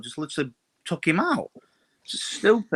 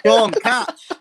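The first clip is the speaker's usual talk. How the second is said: playful call; 6 semitones higher, 6 dB louder than usual. Acted. Pretends to throw a chocolate egg.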